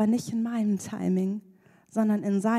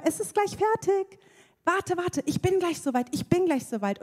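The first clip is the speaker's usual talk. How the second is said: put-on soothing a crying baby; 7 semitones higher than usual. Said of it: "Und ich sag immer".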